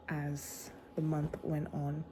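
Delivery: slow hushed whisper. Real, not acted. Trailing whispered aside.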